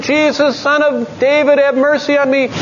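high, forceful cry for mercy; imitated screaming plea. Blind beggar calling to Jesus, high screaming plea. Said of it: "Was blind and screaming".

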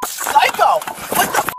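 yelling in real rage; screaming at the officer; furious shout. Real, not acted. Woman screams at arresting officer.

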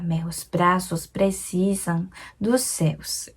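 slow whisper hissing each s; whispered s sounds. Shown as a demonstration. Teacher whispers the line to show its s sounds. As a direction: hiss the s sounds softly. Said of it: "이렇게 시옷이 많은데요".

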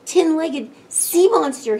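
high, fast fretting; worried. A narrator's reading. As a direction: rush it out in rising worry. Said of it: "he worried".

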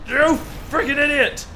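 exasperated insult at full voice; angry yell. Real, not acted. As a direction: shout it in frustration. Yelling at a crashing driver.

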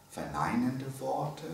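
ordinary talk

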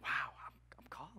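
breathless hushed wonder; stunned soft whisper; awed whisper. Retold or imitated. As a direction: whisper it as if you can't believe it. Awed whisper at God's call.

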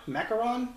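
"Macaron" in three syllables, mac-a-RON, as a question; alternative pronunciation. Shown as a demonstration. Host tests another reading, "Macaron" as three syllables, mac-uh-RON. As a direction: try "Macaron" with a middle uh, questioning. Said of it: "Is that how that's pronounced?".

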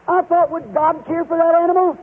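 usual speaking voice

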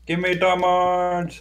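slow, high demanding call; drawn-out greedy cry; greedy shout. Real, not acted.

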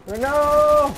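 sudden high, loud yell of dismay; real high-pitched gamer yell. Real, not acted. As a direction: yell it out right into the microphone. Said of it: "That yell though".

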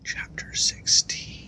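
slow whispered heading. A narrator's reading. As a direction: whisper the chapter title.

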